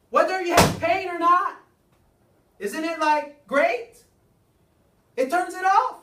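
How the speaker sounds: loud, high, fervent selling of the point; preaching at full force. Real, not acted. Speaker rousing the seminar audience, in a loud preaching voice. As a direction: preach it to the crowd at full volume.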